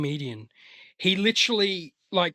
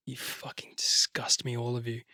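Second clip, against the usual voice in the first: muttered disgust; 6 semitones lower, 7 dB quieter than usual. Retold or imitated. Loner looking at everyone having a great time, whispered disgust. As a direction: whisper it with quiet contempt. Muttering contempt at happy crowd.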